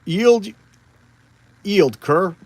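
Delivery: loud, hammed-up wrathful god; Thor, pissed off. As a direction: thunder out a threat at a cowering foe. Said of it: "So Thor is just pissed".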